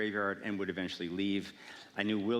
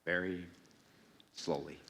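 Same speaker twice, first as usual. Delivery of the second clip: long pauses between words, dropping low; very slow delivery; 3 semitones lower than usual. Shown as a demonstration. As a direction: demonstrate old-person slowness, word by word. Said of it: "The way that you actually convey an old person is to speak".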